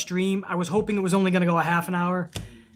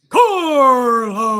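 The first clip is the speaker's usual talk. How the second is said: loud, high, drawn-out name call; ring announcer voice; 6 semitones higher, 9 dB louder than usual. Retold or imitated. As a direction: introduce him like a ring announcer. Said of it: "I'm gonna give you the Fink".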